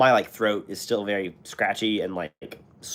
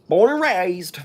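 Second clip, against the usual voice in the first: higher and louder, swelling with mock pride; proud patriotic flourish; 8 semitones higher, 6 dB louder, much slower than usual. Shown as a demonstration. Streamer telling chat where he's from, with mock patriotic pride. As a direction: belt it like a proud hometown boast. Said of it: "I don't know why I said it like that".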